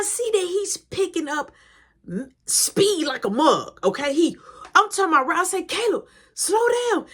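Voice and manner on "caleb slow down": high call after a biker. Retold or imitated. Calling after brother on bikes.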